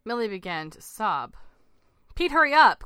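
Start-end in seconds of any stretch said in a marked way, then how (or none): 2.2-2.9 high, loud urgent shout. A narrator's reading. Frightened woman shouting to her husband in the sea, urgent. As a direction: yell to hurry someone along. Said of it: "Claire shouted at the man from the sea".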